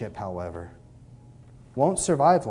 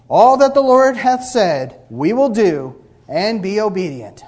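solemn vow of the people; 4 semitones higher, 6 dB louder, slower than usual. Retold or imitated. People vowing to obey God.

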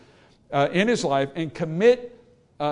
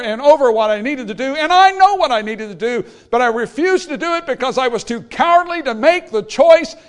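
loud, higher, pleading confession; earnest confession; 6 semitones higher, 8 dB louder than usual. Retold or imitated. The king confesses his wrong to Jeremiah, loud and earnest. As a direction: cry out admitting you were wrong.

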